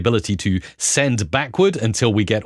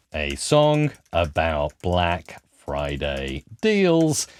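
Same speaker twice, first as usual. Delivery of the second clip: slow, spaced-out words while typing; typing along; 7 semitones lower, 4 dB quieter, much slower than usual. Real, not acted. Presenter typing a song prompt for viewers, slow and halting. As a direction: say it word by word as you type.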